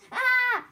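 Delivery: loud, high scream; startled scream. A narrator's reading. Boucle d'Or waking among angry bears, startled scream.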